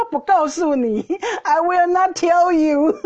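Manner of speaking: high, slow, sing-out taunt; teasing refusal. Retold or imitated. Imitating him refusing to reveal the hiding place, teasing refusal. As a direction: draw it out teasingly, full of laughter. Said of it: "他说，哈哈".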